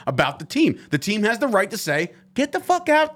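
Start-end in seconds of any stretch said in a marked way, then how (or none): 2.3-3.1 high-pitched, imitated brush-off; retold dismissal. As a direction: throw someone out, pitch jumping way up. Voices team dismissing a reporter.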